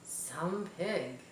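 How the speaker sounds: barely voiced, awed and soft; hushed whisper. A narrator's reading. Farmer staring at the web, whispering in awe. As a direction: breathe the words in quiet wonder. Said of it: "whispered Mister Zuckerman".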